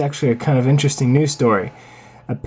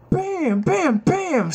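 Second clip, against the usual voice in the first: excited; 13 semitones higher, much slower than usual. Real, not acted. Cat swipes again.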